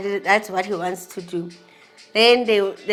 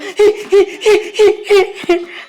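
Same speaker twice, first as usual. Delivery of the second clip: laugh; 10 semitones higher, 6 dB louder than usual. Real, not acted. Recalling dad crying, emotional laugh.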